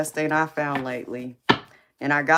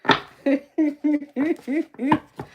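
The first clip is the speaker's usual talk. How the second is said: a high, louder burst of giggling; high squealing laugh; 12 semitones higher, 5 dB louder than usual. Real, not acted. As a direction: squeal with laughter at your own joke. Laughing after mock threat.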